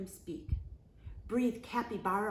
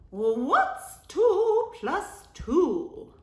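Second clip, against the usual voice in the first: high, slow, loud teacher voice; high singsong schoolteacher; 9 semitones higher, 10 dB louder, much slower than usual. A narrator's reading. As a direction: ask the class clearly and slowly. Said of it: "asked Miss Fuzzleworth".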